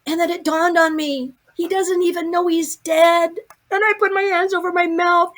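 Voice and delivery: high, excited storytelling; breathless-with-wonder retelling, pitched high; amazed realization. Retold or imitated. Relives the shocking realization.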